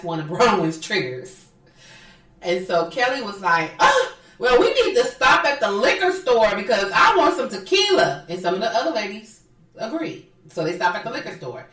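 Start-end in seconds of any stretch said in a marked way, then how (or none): 3.8-8.2 high, loud mock insistence; imitating a pushy housewife. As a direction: play up her brash demand. Voicing Kelly demanding tequila.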